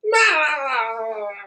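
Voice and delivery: high trilling cartoon laugh; high goofy cackle; SpongeBob cackle. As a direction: let out a long, falling cartoon sponge cackle. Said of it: "give 'em the SpongeBob treatment".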